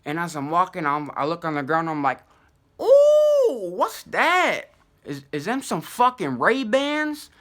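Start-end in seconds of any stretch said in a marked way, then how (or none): 2.8-7.3 squeaky high "ooh", then slow marveling question; excited squeal. Retold or imitated. Tripping guy spotting fake Ray-Bans, squealing with greed. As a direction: squeal like you found treasure.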